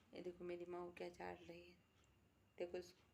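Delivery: soft low aside while the pet licks; hushed. Real, not acted. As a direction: hush so the pet isn't disturbed.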